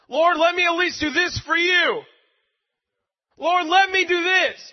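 high, loud desperate begging; shouted plea. Retold or imitated. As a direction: cry out to the Lord. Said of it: "shouting plea to the Lord".

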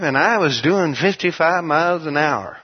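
usual speaking voice

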